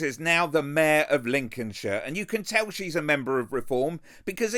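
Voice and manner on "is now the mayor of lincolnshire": ordinary talk, nothing marked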